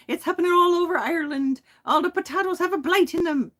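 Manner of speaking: high-pitched, hammed-up Irish accent. Shown as a demonstration. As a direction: wail it in a stagey Irish brogue.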